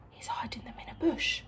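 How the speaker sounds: breathless secret whisper; gasp, then whisper it like a secret; hushed aside to little listeners. Real, not acted.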